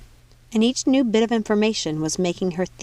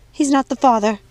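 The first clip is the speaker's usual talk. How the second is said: louder, higher desperate lie; 5 semitones higher, 6 dB louder than usual. Acted. Mania lying to save her lover, desperate raised voice. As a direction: lie loudly to save him. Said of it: "Mania lied".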